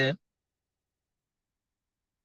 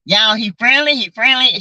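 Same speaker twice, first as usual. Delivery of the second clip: ordinary delivery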